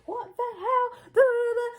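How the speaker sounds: high wailing mock sobs; acting betrayed to her boyfriend, high mock wailing; fake hysterical crying. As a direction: ham up heartbroken crying. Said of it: "I'm there hysterical".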